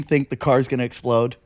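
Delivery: normal talking voice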